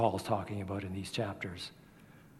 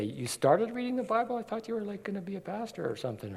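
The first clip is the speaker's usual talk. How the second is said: much higher, mock disbelieving question; incredulous listener; 8 semitones higher, faster than usual. Imagined listener questioning the preacher, incredulous. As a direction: voice a doubter who can't believe it. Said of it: "And you might say".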